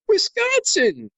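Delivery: drawn-out high cheer of Wisconsin; excited cheer. Retold or imitated. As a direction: cheer it like a proud fan. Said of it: "people saying".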